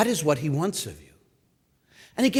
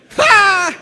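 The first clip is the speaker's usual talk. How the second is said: loud scoffing laugh; 8 dB louder than usual. Real, not acted. Scoffing at the idea.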